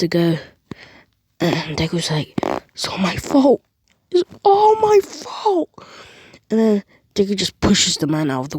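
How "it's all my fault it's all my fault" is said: from pained to a high, slow wail; rising despair. Acted. Deku realizing his own mistake, building from despair to wail. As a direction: let the guilt build into a cry.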